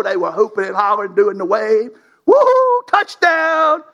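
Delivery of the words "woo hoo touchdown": high, loud mock stadium cheer; hammed-up football crowd yell. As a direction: yell touchdown like a fan in the stands.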